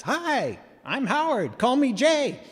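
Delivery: high, bright, friendly greeting; imitating Howard. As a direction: pop up high like a cheerful little guy. Landowner hops out of truck.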